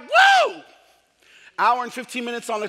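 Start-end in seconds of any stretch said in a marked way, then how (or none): 0.0-0.6 high, loud mock cheer; excited whoop. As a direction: whoop like it's a party. Expectations are like.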